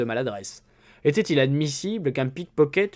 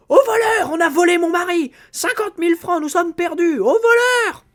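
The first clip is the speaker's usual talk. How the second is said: shrieking, much higher and louder; screaming for help; 8 dB louder than usual. Acted. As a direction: scream "thief" across the racecourse crowd. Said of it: "Elle poussa de véritables hurlements". (elle hurle au voleur, très aigu et fort)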